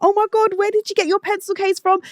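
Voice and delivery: very high-pitched excited squeal; gushing school friends. Retold or imitated. School friends admiring first-day outfits, imitated excited gushing. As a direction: imitate friends gushing over each new item. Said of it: "You want all your friends to be like".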